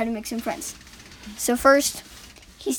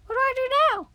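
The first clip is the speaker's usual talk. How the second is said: much higher, small character voice; squeaky coal voice. Acted. Coal piece names himself in a high voice. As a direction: talk like a tiny lump of coal.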